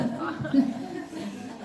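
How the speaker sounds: real laugh at the memory; hearty laugh. Real, not acted. Laughing at own story.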